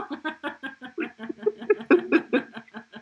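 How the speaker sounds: big high laugh; loud hearty laughter. Real, not acted. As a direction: burst into laughter. Laughing after Elsa line.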